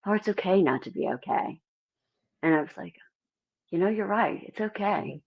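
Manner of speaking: a touch of hushed comfort; gentle comfort. Retold or imitated. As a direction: say it softly like comforting a friend. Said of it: "they were like".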